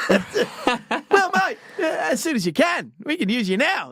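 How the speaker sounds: high, hammed-up Aussie voice; insistent Australian recruiter on the phone; too-Australian accent. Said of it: "was real Australian accent".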